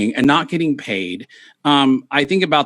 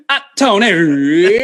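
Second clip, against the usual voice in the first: drawn-out mock call; 4 semitones higher, 4 dB louder, much slower than usual. Shown as a demonstration. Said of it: "But now I go like".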